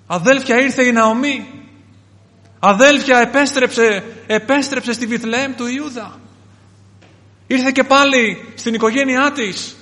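high, loud calling out; acted townsfolk's cry, with long stretched endings; excited announcing. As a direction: spread joyful news through the village. Acts townsfolk calling out news.

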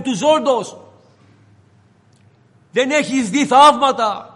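Jesus reproaching Peter, swelling into a loud reproach; preaching rebuke. Acted. Jesus reproaching Peter for his doubt, building to full-force preaching.

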